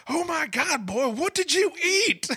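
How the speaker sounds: very high, loud, slow disgusted cry; Tom Arnold disgusted. Retold or imitated. Host imitating Tom Arnold at the smelly stall. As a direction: recoil at the smell. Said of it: "He goes".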